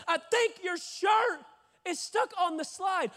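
high, slow, shouted repeat. Retold or imitated. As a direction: spell it out slowly for someone not hearing. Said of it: "Wh-, wh-, what?".

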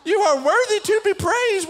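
high, loud mimicked praise; mock a little kid praising like a minister; hammed-up fervent cry of worship; imitated child preacher. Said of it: "forty-two-year-old minister".

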